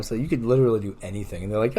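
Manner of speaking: normal talking voice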